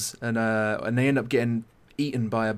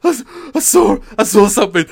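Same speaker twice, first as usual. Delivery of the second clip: high, loud panicked gasping; acted terror, voice shooting up; terrified; 9 semitones higher, 11 dB louder, faster than usual. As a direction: blurt it out scared witless. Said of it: "the guy shits himself".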